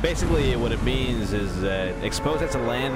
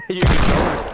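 laughing shout; 3 semitones higher, 10 dB louder than usual. Real, not acted. Teasing teammate.

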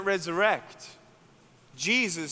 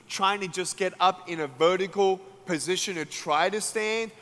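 usual speaking voice

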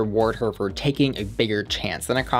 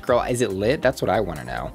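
usual speaking voice

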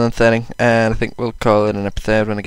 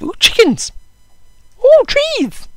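very high squeaky falsetto; weirdest voice; slower than usual. Pretend. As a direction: squeal like a wide-eyed cartoon creature. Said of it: "the weirdest voice I think I've ever done".

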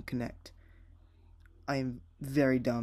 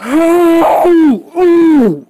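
sharp high gasping ooh, much louder; startled gasp; 21 dB louder than usual. Real, not acted. Driver hit out of nowhere gasps in shock. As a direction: gasp out loud as the car gets hit. Car suddenly crashes, gasp.

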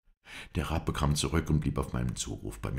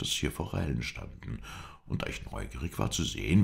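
ordinary delivery

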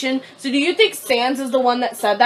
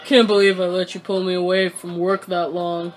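low, laid-back character voice; game character voice; 6 semitones lower, 3 dB quieter than usual. Acted. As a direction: read it low and level, in character.

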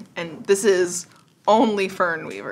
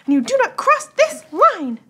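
pixie voice; 13 semitones higher, 4 dB louder than usual. Acted. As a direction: lay down the rule in a high pixie voice. GM voices pixie setting rules.

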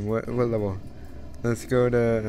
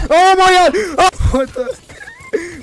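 high loud startled yell; startled shout; 12 dB louder, much slower than usual. Real, not acted. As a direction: yell in sudden surprise. Surprised by enemy.

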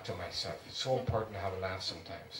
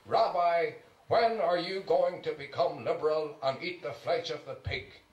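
loud, higher-pitched call; 6 semitones higher, 7 dB louder than usual. A narrator's reading. Priest to a rabbi in a restaurant, very loud. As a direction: project it loudly across the table. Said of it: "in a very loud voice".

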